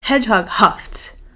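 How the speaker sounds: plain reading voice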